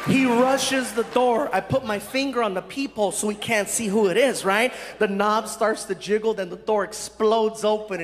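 normal talking voice

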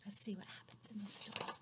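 quick hushed whisper. Acted. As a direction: whisper it to build suspense. Whispered lead into story.

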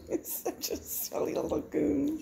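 said through a laugh. Real, not acted. Owner amused by her silly dog, laughing. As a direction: laugh the words out.

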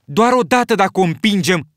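loud, high eager cry; enthusiastic shout. A narrator's reading. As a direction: shout it with boyish excitement. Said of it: "strigă Jack entuziasmat".

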